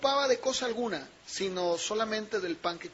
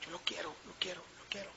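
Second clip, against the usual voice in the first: longing murmur; 2 semitones lower, 15 dB quieter, much faster than usual. Retold or imitated. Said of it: "lo anhelaba de una manera vehemente".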